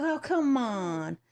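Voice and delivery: imitated wheedling, slow and high; coaxing. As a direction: stretch it out to cajole him. Retold coaxing of reluctant fiance.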